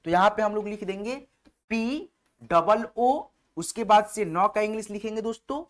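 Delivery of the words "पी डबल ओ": letters spelled out slowly one by one; slow dictation to students; slow spelling. Shown as a demonstration. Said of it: "spelling letters out for students".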